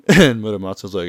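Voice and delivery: ordinary talk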